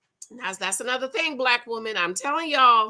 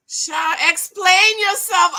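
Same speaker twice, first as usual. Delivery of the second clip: teasing demand; 7 semitones higher, 8 dB louder, slower than usual. Real, not acted. Teasing viewer Andrea in chat.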